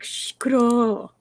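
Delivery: long, slow shush into "kuro"; shushing demo. Shown as a demonstration. Teaching her how to say shut up in Korean, slow shushing. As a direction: demonstrate a drawn-out hush. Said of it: "Means shut up".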